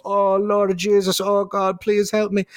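high pleading Irish-Scottish voice; Scottish-Irish accent. Acted. Ruined Job begs God for help, mock Irish accent. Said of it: "Why did you turn Scottish there?".

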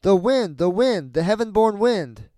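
put-on high, loud children's chant; children answering. A narrator's reading. Children answer the voice from the room, high and loud. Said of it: "The children answered".

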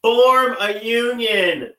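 slow, loud call to a crowd of lawyers to form a union; rallying call. Acted. Urging the audience to unionize, slow and loud. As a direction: punch each word of "Form a union" out slowly and loud. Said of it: "you really wanna stick it to the tyrants".

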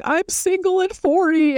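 high, slow put-on whine; imitating Schwartz venting at the wall; mock Schwartz. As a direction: mope it out in a silly high voice. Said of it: "Schwartz actually does, like".

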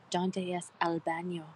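low, smouldering put-on sexy voice; mock sexy. Showing off sexy Spanish to a friend, mock sultry. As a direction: say it low like a playful seduction. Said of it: "how sexy does this sound".

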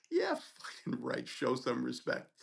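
slow, low-key scornful echo; defiant retort. Retold or imitated. Student answering the resident who yelled at him, defiant. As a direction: throw it back slowly, unimpressed.

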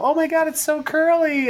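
high-pitched imitation of gushing admirers; gushing women. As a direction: mimic women cooing over curly hair. Imitating women admiring his hair.

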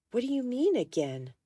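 high, taken-aback question; startled question. A narrator's reading. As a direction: ask it as if thrown by the news. Startled reply in heated quarrel.

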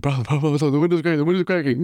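high, urgent repeated bro; hammed-up alarm as the glass cracks; mock panic. Panicked retelling, window cracking.

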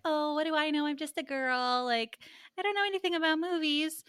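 high-pitched mock-clueless girl; playing dumb. [name] telling the filmmaker she's just a girl, playing dumb. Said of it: "she's playing dumb".

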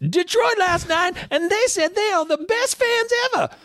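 hammed-up high frontman talking to fans. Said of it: "he'd do it in that voice, a little high, high voice".

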